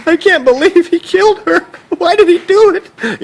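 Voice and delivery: high, wailing, teary mock voice, slow at the end; mock drunken weeping. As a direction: ham up a drunk sobbing over a TV death. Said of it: "like, getting, and getting emotional".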